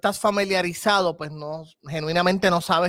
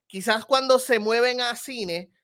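ordinary delivery

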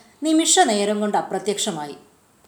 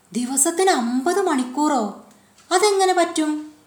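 curious; 7 semitones higher than usual. A narrator's reading. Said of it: "ജോസിന് കൗതുകം".

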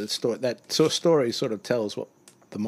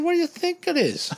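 teasing; 4 dB louder than usual. Retold or imitated. Speaker teasing driver.

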